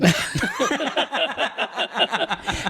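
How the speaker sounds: long, high, real laughing fit; long laughter. Real, not acted. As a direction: dissolve into laughter. Laughs at the "Ben" punchline.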